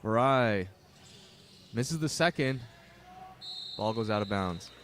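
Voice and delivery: play-by-play announcer calling the first half; live play-by-play. Acted. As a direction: keep the game call going. Live basketball play-by-play call.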